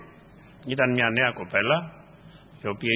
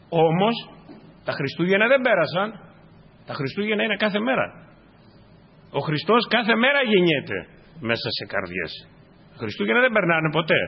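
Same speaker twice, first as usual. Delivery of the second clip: rising exhortation; 6 semitones higher, 3 dB louder than usual. Real, not acted.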